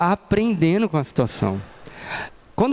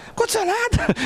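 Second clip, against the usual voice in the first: mock angry wife. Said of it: "Brava, né?".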